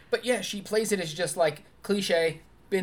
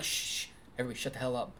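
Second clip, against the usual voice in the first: hushing; 4 semitones lower, 7 dB quieter, much faster than usual. Retold or imitated. Hushing others during the movie.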